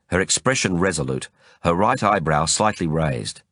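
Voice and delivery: ordinary delivery, nothing marked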